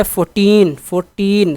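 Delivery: Fourteen with a long, stressed टीन; stress on second syllable. Shown as a demonstration. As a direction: stretch the टीन in fourteen, fourteen. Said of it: "second पे है".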